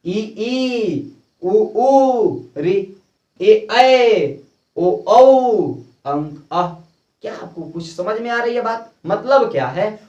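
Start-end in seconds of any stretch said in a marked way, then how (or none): 0.0-6.8 loudly called out vowels; teacher calls vowels out loud; loud recitation. Shown as a demonstration. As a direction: call out the vowels to the class. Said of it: "अब कैसे पढ़ते हैं?".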